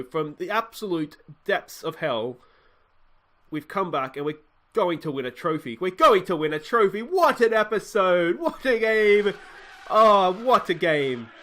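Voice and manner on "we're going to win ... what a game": louder, higher, laughing with joy; elated celebration. Real, not acted. Gamer celebrating a comeback win, elated and laughing. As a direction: savour the comeback out loud.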